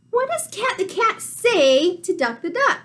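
ordinary delivery, nothing marked

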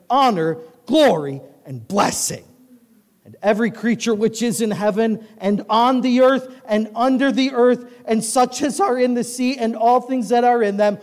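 high, loud preaching cadence; full-force scripture reading. Acted. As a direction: proclaim the verses like a revival preacher. Reads the passage at full force.

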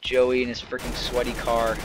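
ordinary talk, nothing marked

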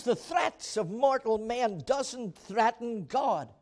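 rising, emphatic preacher's cadence; preaching at full force. Acted. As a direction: proclaim it like a revival preacher. Repeated preached declarations.

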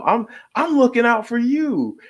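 high, fast, fake-nice entitled; mock a nosy neighbor demanding ID; Karen voice. Neighborhood Karen confronting a stranger, high fake-nice voice. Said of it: "a Karen in some ways".

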